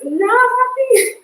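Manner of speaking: high, slow, sulky little voice; playful whiny voice. Acted. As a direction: pout it out as if the foot sulks. Jokes about her sore foot.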